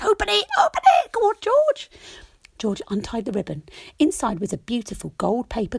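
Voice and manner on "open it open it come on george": high, loud chorus of animals; very high, loud excited animal voices. Acted. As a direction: call out eagerly like little animals.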